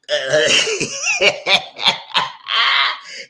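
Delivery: high, loud shrieking laugh. Real, not acted. Laughing at David's list of complaints, loud high cackle.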